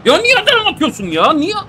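very high and loud, outraged; furious scream. Real, not acted. Gamer screaming at his player for a bad pass, furious.

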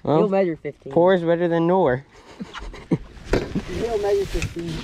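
usual speaking voice

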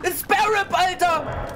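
high, loud excited shrieking; freaked-out yelling. Real, not acted. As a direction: yell in horrified excitement. Reacting to a grotesque monster.

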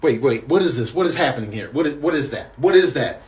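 ordinary delivery

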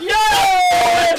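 high, loud yes; goal yell. Real, not acted. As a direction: cheer the goal. Goal celebration.

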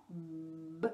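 "B" held long, a buzzing voiced b between closed lips. Shown as a demonstration. Speech therapist to parent, a long humming b. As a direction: hum the "b" through closed lips so the lips vibrate. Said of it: "faire exprès d'allonger le son".

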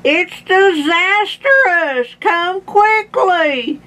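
slow, very high-pitched alarm; hammed-up old man calling for help; Grandpa shouting. A narrator's reading. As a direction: stretch each word in a high panicked call. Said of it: "He was still shouting".

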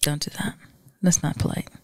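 whispered scold; wife scolding husband in a whisper. Real, not acted. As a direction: hush him with a whisper. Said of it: "whispered scolding".

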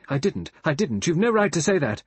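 loud, high burst of denial; vehement. A narrator's reading. Vera hotly denies Lombard's accusation, vehement. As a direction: protest hotly, raising pitch and volume. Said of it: "Vera said vehemently".

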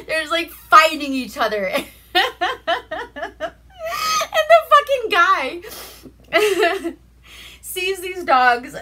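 can barely get it out for laughing; laughing hard. Real, not acted. Telling a friend about the dog fight, cracking up. Retelling funny movie scene, cracking up.

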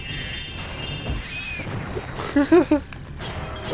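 long airy high laugh; high giggle. Real, not acted. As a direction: giggle with delight. Amused by game.

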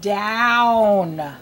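"Down" stretched on the OW; isolated "Down", long falling vowel; drilling OW words. Shown as a demonstration. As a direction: draw out the vowel of "Down". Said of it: "words that have ow".

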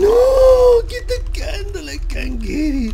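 shrieking for the candle; panicked high yell. Real, not acted. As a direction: yelp in panic as he is caught. Grabbed by the monster.

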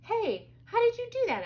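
high, loud exclamation; astonished. A narrator's reading. Brother sees flowers sprout, astonished. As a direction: burst out in amazement.

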